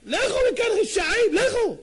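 high, very loud order to leave; acted thundering rebuke; furious banishing shout. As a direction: drive them off with a shout. Rabbi driving off wicked crows.

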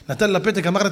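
ordinary talk, nothing marked

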